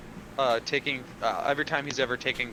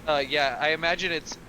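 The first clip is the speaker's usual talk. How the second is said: usual speaking voice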